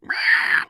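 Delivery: loud rasping dinosaur squawk; imitated flying-reptile cry; pterodactyl screech. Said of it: "he'd be like".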